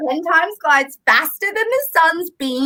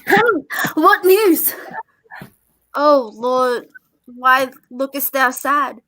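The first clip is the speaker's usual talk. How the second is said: usual speaking voice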